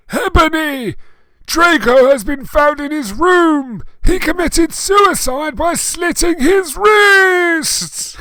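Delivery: over-the-top screeching alarm; frantic shout. Acted. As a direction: shriek the news in mock panic. Frantic announcement of Draco's suicide.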